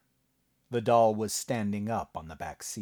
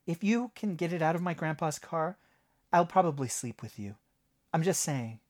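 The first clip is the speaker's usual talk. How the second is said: put-on young woman voice; 8 semitones higher than usual. Jenny's dialogue voice.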